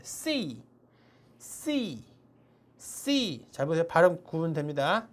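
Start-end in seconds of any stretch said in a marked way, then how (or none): 0.0-3.5 C said quick and sharp with a strong s. Shown as a demonstration. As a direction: snap out the C quickly. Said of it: "이거 좀 빨리 발음하세요". (C를 빠르고 세게)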